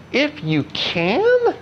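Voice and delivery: slow, high, questioning echo of the words; incredulous echo. Retold or imitated. Jesus echoing the father's doubt, incredulous and slow. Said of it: "he says".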